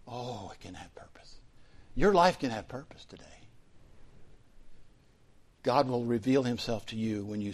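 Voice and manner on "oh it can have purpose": a soft unvoiced whisper of hope; whispered reassurance. Real, not acted. Pastor whispering comfort to a friend who feels purposeless. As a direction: breathe it gently, like a secret promise. Gentle reply to despairing friend.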